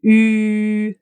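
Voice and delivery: Ü held, lips rounded; a long rounded Ü; German ü. Shown as a demonstration. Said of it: "How to pronounce that".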